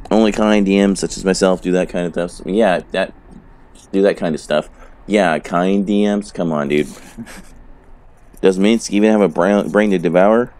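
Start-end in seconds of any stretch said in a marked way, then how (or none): none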